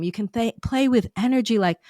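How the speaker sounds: normal talking voice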